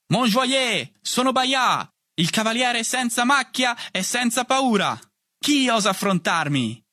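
high-pitched heroic challenge; yelled battle cry. A narrator's reading. Boy playing Bayard charging the big boys, high battle cry.

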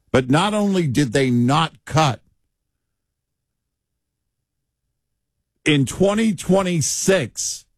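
frustrated, pitch climbing; real frustration. Real, not acted. Commentator calling out broken promises, frustrated. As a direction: press the point with rising frustration. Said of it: "if you hear frustration in my voice".

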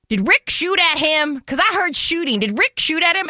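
mock alarmed older lady; alarmed neighbor lady. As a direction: play a startled neighbor asking about gunshots.

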